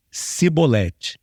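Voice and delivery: slow cibolete with an s; drawn out, s instead of sh; mispronouncing. Retold or imitated. Fleeing Ephraimite gives himself away: slow cibolete. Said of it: "se entregava".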